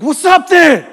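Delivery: high, loud confrontational yell; challenging shout. Retold or imitated. Speaker retelling a car confrontation, high challenging shout. Acts out confronting other driver.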